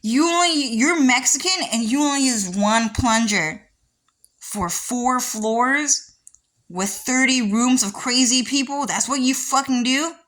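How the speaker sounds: high, loud incredulous rant; retold outrage. Yelling at staff about the lost plunger, outraged and high. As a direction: demand an answer, outraged. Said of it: "I was like".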